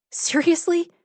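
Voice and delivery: high, scornful disbelief; incredulous challenge. A narrator's reading. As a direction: throw it back at her, incredulous. Carly challenging the dean.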